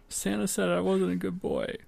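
put-on high, soft, teary child's voice; sniffling little boy. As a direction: say it like a hurt kid fighting tears. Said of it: "and sniffling says".

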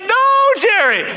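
high, fired-up imitation of a client; insistent client. Acted client pushes agent for feeling.

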